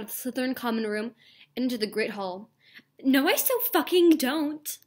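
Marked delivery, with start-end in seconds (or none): 3.0-4.8 higher, slow, put-on teen yell; indignant teen shout, hammed up; shouted denial. A narrator's reading. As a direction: snap it back in a mock shout. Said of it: "I shouted".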